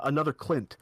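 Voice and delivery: usual speaking voice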